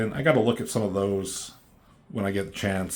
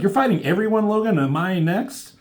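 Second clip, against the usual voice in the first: put-on higher pitched young girl quote; Kitty voice; 10 semitones higher, 4 dB louder than usual. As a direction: lift up into Kitty's accusing voice. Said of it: "And Kitty's like".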